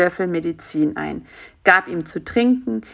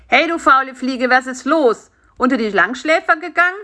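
higher, louder, cheeky prodding; teasing wake-up call; 6 semitones higher, 4 dB louder than usual. A narrator's reading. Karl-Heinz prods the sleeping fly, teasing and calling. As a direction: rouse a lazybones with a teasing call.

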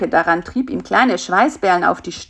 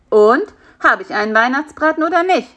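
louder, higher, fast smug challenge; smug know-it-all; 7 semitones higher, 7 dB louder, faster than usual. A narrator's reading. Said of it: "fragte Karl-Heinz besserwisserisch". (besserwisserisch, lauter, höher und schnell)

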